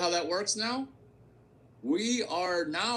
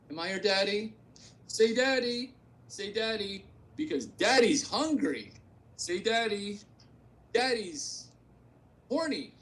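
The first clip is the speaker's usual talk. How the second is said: higher, teasing daddy voice, pushing for an answer; mock demanding daddy; 5 semitones higher than usual. Host teasing a spending partner, smug higher daddy voice. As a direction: coax like a smug sugar daddy.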